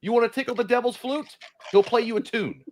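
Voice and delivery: hammed-up preachy warning; mock scolding. Mock rant scolding the Ouija players.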